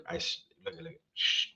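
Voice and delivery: hushed whisper; whispered shush. Real, not acted. Hushing himself mid-sentence.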